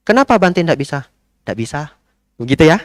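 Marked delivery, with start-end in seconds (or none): 0.1-1.0 higher, pressing "why"; lightly imitating the lady; pushy lady. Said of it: "Saya nggak bisa, Bu". 1.4-1.8 soft, smiling no; gentle smiling no; smiling refusal. Shown as a demonstration. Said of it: "Sambil senyum ya".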